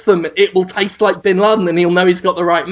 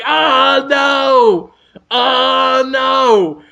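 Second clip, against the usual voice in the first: hammed-up despairing shout, higher and louder; mock anguished wail; 4 semitones higher, 3 dB louder, much slower than usual. The villain wails in hammed-up despair as rain washes him. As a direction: wail it clutching your face. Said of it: "just holding his face, going".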